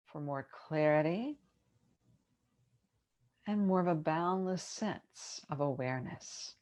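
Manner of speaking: unhurried yoga nidra guidance with long pauses; measured, spacious relaxation-teacher delivery; guided meditation. Acted. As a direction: ease them into rest, phrase by phrase.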